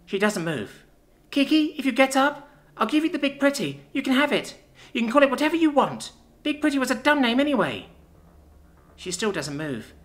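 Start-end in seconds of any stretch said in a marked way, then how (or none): 1.3-7.9 put-on high pleading child voice; little sister, bargaining. Child offering her shell to wake her sister, high pleading.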